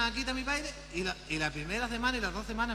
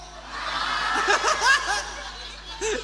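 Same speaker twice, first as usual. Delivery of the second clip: high, loud wheezing laugh; breathy laughter; 11 semitones higher, 8 dB louder than usual. Real, not acted. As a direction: laugh until no voice comes. Laughs at own story.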